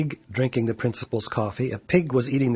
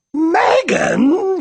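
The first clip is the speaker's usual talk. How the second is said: high, loud bellow of her name; father shouting for Megan; yell; 8 dB louder than usual. A narrator's reading. Father bellowing at Megan, loud high yell. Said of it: "yelled her father".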